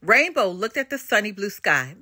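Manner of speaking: plain reading voice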